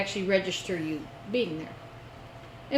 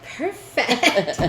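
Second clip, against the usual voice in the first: higher "Perfect" through laughter; mock registrar; 5 semitones higher, 4 dB louder than usual. Mocking the registrar to friends, laughing. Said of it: "would you actually go".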